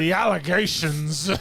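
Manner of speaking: put-on honeyed courtroom Southern accent; southern lawyer accent. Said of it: "my Southern lawyer impression".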